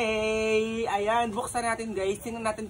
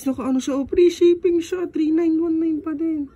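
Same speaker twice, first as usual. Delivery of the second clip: ordinary delivery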